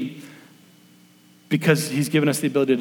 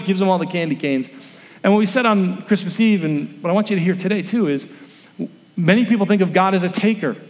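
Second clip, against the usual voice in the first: ordinary talk, nothing marked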